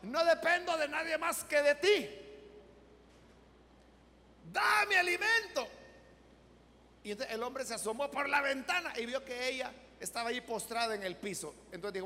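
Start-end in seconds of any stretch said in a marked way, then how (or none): 0.0-5.7 high, insistent plea to God; imploring prayer, peaking high on the last plea; praying old woman. Retold or imitated. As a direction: cry out a retold prayer, raised and emphatic. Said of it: "Y ya estaba la ancianita".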